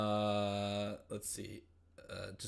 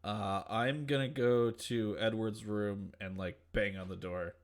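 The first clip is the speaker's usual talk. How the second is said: normal talking voice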